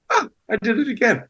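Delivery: airy, high "oh, I did it again"; light self-forgiving shrug. Retold or imitated. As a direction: let the mistake go with a high light sigh. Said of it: "I just go".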